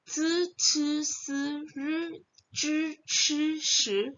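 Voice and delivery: zi, ci, si, ri, zhi, chi, shi ending in a closed "e" sound, not "ee"; pinyin syllable demo. Shown as a demonstration. Teacher reciting syllables whose I is read as E, pronunciation demo. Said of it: "belakangnya dibaca E".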